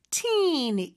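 put-on "teen" with the ee held long. Shown as a demonstration. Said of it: "이 발음 길게 해주시면 되죠".

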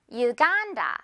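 "유간다" as yoo-GAAN-da; model pronunciation. Shown as a demonstration. Teacher has learners listen and repeat, "유간다" as yoo-GAAN-da. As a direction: stretch and stress 간 in "유간다" after a clear "yoo". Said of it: "One more time".

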